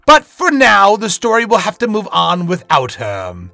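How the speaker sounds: loud, drawn-out theatrical narrator; dramatic host narration. Acted. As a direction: savour the cliffhanger like a villainous host.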